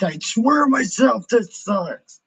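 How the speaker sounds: high, loud booming pretend deity; mock God voice. Streamer fakes the missing God voice, hammed-up. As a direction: play a put-on God voice to the chat. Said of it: "But if I was in God voice".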